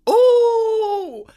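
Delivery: loud, very high drawn-out ooh; high whooping ooh. Acted. Reacting to the friend's roast, loud high whooping ooh. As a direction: whoop like a crowd hearing a burn. Relishing the repeated comeback.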